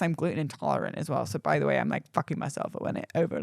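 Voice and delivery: ordinary delivery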